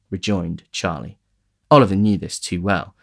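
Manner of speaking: normal talking voice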